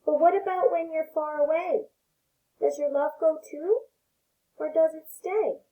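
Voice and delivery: gentle read-aloud voice for little ones; storybook reading. Acted. Teacher reading a picture book to her pre-K class, gentle storytelling.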